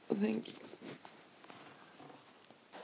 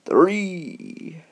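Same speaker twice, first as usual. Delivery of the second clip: loud hammed-up passionate kisses; Addams Family romantic; 5 semitones lower, 12 dB louder, much slower than usual. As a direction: ham up a lovesick gentleman. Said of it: "how did he go again?".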